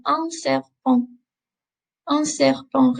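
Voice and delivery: "serpent" ending on a nasal "an", no T; modelling "Un serpent" slowly, nasal ending. Shown as a demonstration. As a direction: let "serpent" fade into the nose. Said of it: "that nasally sound again".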